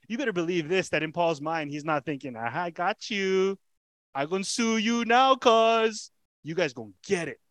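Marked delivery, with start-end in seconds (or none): 2.4-7.4 loud, high mock gloat, "got you". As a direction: ham up a vindictive taunt. Imagined gloating Paul, preacher denies.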